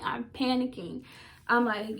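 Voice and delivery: ordinary delivery, nothing marked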